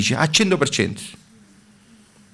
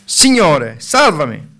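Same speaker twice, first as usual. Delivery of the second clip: loud cry for help in a sermon reading; a preacher's loud read-aloud cry of a drowning man; Peter crying out; 3 semitones higher, 6 dB louder than usual. A narrator's reading. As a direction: call out as if sinking. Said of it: "gridò".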